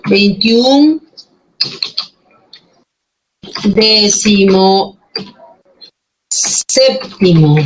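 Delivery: slow dictation with drawn-out syllables; dictado lento. Shown as a demonstration. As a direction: spell it out slowly, syllable by syllable. Dictating words while writing them. (dictado pausado, sílaba por sílaba)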